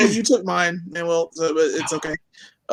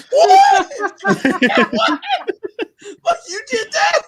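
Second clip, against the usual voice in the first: shocked outrage; slower than usual. Retold or imitated. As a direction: yell it in stunned disbelief. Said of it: "I was like".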